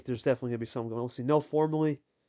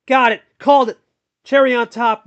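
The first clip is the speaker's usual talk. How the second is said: big triumphant shout, much higher and louder; excited call; 10 semitones higher, 14 dB louder than usual. Real, not acted. Commentator calling the final winning card, triumphant shout. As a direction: yell it like the winning moment. Game-winning play called.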